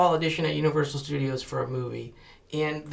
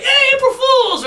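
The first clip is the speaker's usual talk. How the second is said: much higher and louder, drawn out slowly; mock cheerful reveal; 7 dB louder, slower than usual. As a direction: sing out the prank reveal slowly. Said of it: "I thought I'd be like".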